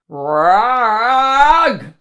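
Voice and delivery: slow, loud, booming narration; dramatic boom. A narrator's reading. Narrator building dread, slow and loud.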